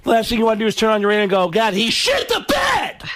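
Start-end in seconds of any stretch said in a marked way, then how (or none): 1.5-3.0 high, drawn-out yell; mock outraged shout. Comic quoting a lover's radio complaint, mock outraged shout.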